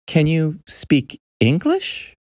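put-on high, careful boy voice; slow clarifying question. Boy clarifying his question to the creature, slow and careful. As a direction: spell it out slowly, lifting at the end. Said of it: "Haruo clarified".

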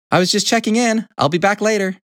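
high-pitched hen reply; hen character. A narrator's reading. Little Red Hen answering big hen, high voice.

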